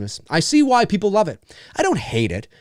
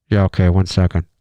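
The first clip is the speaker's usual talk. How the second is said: deep hesitant reply; 10 semitones lower, 3 dB louder than usual. Pretend. Pretend homeowner answering the intercom, low and deadpan. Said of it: "there's, like, a dramatic pause".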